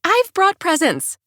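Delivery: high, slow, sing out the good news; beaming, dangle the gift; gaily. A narrator's reading. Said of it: "I say gaily".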